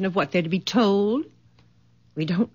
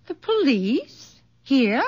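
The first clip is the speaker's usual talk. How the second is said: startled surprise; 8 semitones higher than usual. Acted. Mother startled by police mention.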